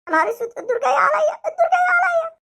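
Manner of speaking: put-on boy's half-laughing, half-crying panic; panicked whimper. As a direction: panic as his turn comes. Boy panics as his turn comes.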